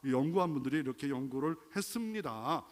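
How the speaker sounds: ordinary talk, nothing marked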